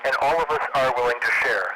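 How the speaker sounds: ordinary talk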